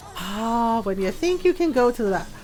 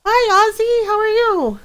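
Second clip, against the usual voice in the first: high cheery greeting; 12 semitones higher, 6 dB louder than usual. Real, not acted.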